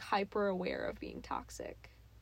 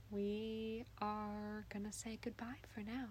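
soft slow whispered sign-off; ASMR whisper; 13 dB quieter, slower than usual. Acted. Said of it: "Why are you whispering?".

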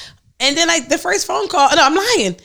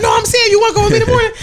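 higher, louder and fast mocking shout; mock ex-husband shout; 10 semitones higher, 5 dB louder, faster than usual. Imitating her ex-husband backpedalling in a loud, fast voice.

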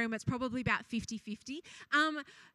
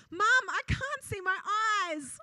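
higher-pitched imitation of a panicked kid; mock crying child; 7 semitones higher than usual. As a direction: wail it lightly, as a retold child's complaint. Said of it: "one was crying, saying".

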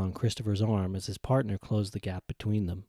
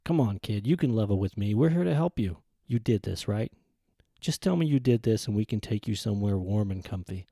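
ordinary delivery

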